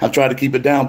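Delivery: ordinary talk, nothing marked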